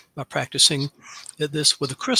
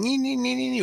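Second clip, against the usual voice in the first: high squeaky nagging nee nee nee; mock brain chatter; 11 semitones higher, faster than usual. Host mimicking anxious bedtime thoughts with high nagging nees. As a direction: mimic a whiny mind that won't stop. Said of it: "your brain is going".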